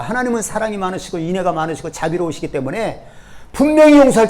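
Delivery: loud, aggrieved whining at God; indignant complaint. Retold or imitated. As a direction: protest as if cheated.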